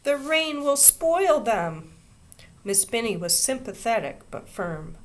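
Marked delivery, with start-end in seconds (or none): 0.0-1.9 put-on higher, louder, slow child's protest. A narrator's reading. Little girl protesting to her teacher, slow. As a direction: plead it slowly. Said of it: "protested Ramona".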